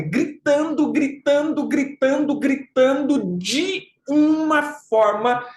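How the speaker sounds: "gritando" repetido em tom alto, sem berrar; ênfase repetida. Shown as a demonstration. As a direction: martele "gritando" agudo e alongue "de uma forma". Encena a alma gritando, repetindo. ("gritando" insistente e agudo, esticando o final)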